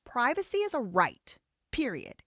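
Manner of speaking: ordinary talk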